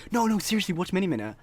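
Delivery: imitating insistent classmates, high-pitched; insistent urging. Classmates urging him to watch.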